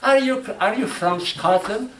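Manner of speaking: high-pitched voice imitating a waitress; waitress's question. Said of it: "the waitress say, asked me".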